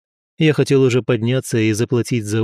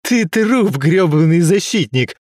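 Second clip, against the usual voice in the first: gurgling threat, higher and strained; 6 semitones higher, 4 dB louder, slower than usual. Acted. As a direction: gurgle the words through pain. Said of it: "пробулькал парень".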